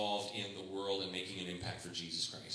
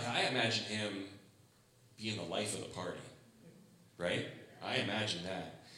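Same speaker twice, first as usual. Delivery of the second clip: normal talking voice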